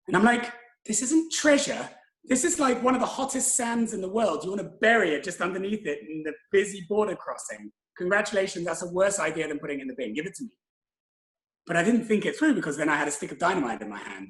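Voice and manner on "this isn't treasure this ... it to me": higher, incredulous sarcastic telling-off; mock-exasperated lecture; exasperated scolding. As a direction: scold him, dripping sarcasm. Scolding guest about burying dynamite.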